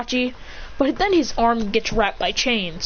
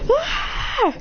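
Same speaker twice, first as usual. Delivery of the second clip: high mock scream of terror; scared scream. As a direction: pretend panicked shriek. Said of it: "Everyone's so scared".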